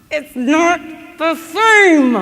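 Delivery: loud, slow, drawn-out cry; heartbroken wail. Retold or imitated. Girl telling the fairy godfather, loud slow wail. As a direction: wail the words out in grief.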